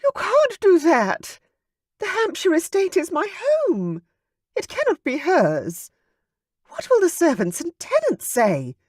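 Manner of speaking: wife protesting to the duke, frantic; distraught mother. A narrator's reading. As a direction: fight back against him in desperation.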